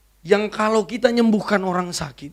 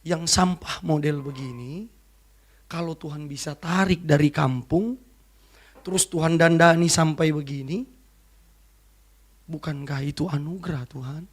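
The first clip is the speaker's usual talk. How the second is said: tearful prayer; 4 semitones lower, 6 dB quieter than usual. Retold or imitated. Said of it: "Saya nangis".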